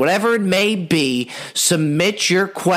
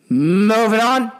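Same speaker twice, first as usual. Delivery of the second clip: drawn-out announcer voice, higher and louder; big slow host voice; 5 semitones higher, 3 dB louder, slower than usual. Acted. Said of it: "segment transition".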